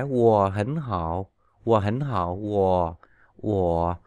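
"wo hẳn hạo" with low falling tones; third tone as low fall. Shown as a demonstration. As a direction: say "wo" low like dấu huyền. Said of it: "đọc thành dấu huyền".

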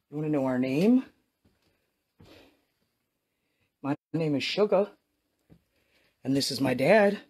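loud, projected call to the spirits. Real, not acted. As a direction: call out to the ghost in the building. Calling out to a ghost.

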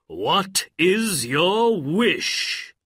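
slow, loud, high genie question; summoned genie. A narrator's reading. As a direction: stretch each word like a grand genie. Jinni asks for the wish.

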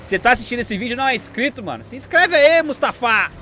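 loud, high hype shout to the chat; hyped call to viewers. Real, not acted. Streamer hyping his viewers to leave a like, loud and high. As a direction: pump up the viewers, almost yelling.

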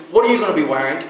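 usual speaking voice

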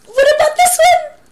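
hammed-up excitement; excited question. Shown as a demonstration. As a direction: hype up the kids.

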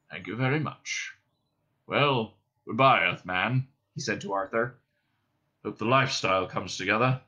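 put-on understated, cold old-man reply. A narrator's reading. Old man accepts dismissal from Benji, coldly. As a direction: answer with chilly restraint. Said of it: "said the old man coldly".

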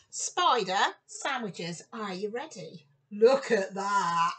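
ordinary delivery, nothing marked

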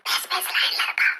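hushed, voiceless urging; urgent whisper. Acted. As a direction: whisper so the caller can't hear. Urging secretly, caller may hear. (அவசரமான கிசுகிசுப்பு)

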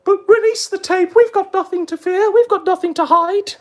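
very high put-on voice; high-pitched mock bravado; mocking imitation. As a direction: parrot them in a high, insistent pitch. Mocking quote of his opponents.

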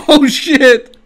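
high, loud startled curse; shocked curse. Real, not acted. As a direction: blurt it out in shock. Game mishap, shocked curse.

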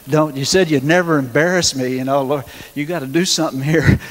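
half-joking panicked begging to the Lord; desperate plea. Retold or imitated. Newly on his own healing line, begging the Lord for help, loud and urgent. Pleading prayer in terror.

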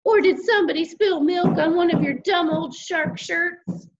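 high, mocking big-sister voice; teasing. A narrator's reading. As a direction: needle him like a bratty sister. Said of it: "teased my sister".

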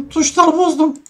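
high laugh, then the words; laughing in dismay. Real, not acted. Gamer laughing after breaking his key settings, high laugh. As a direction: laugh at your own mess-up.

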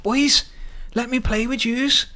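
high, eager call across the pitch. Retold or imitated. Calling to kids playing football to join in, eager call. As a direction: shout over to the kids hopefully.

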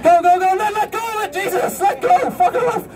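high urgent shouts to let go; frantic high yelling. Real, not acted. Frantic high shouting. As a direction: performer on the unicycle yelling at the helpers to let go. Countdown, helpers must let go.

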